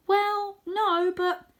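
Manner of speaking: high stammering cat voice; young cat Tam, unsure; hesitant Tam. Acted.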